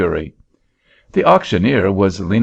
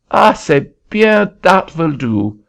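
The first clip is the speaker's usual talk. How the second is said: put-on high, lilting French-accented Frenchman calling out; a Frenchman hailing a farmer in a French accent; 7 semitones higher, 4 dB louder than usual. Frenchman calling to a farmer, high French accent.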